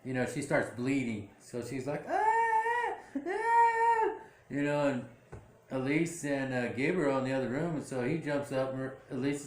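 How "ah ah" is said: very high wailing cry of pain; imitated high wail; mock pained cry. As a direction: cry out high like she's bleeding. Bleeding woman cries out.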